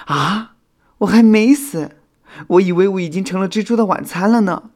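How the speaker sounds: high, loud disbelief; astonished. A narrator's reading. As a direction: cry out in shocked relief. Revived firefly learns it is alive. (惊讶得难以置信)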